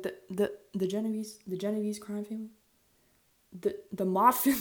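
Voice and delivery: incredulous, rising into a laugh; can't believe it, laughing; surprised disbelief. Retold or imitated. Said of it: "I was like".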